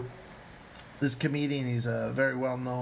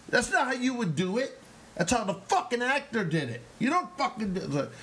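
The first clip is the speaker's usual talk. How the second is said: high, heated mock scolding; yelling; 11 semitones higher than usual. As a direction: imitate a furious acting teacher. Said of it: "'cause he yelled at me".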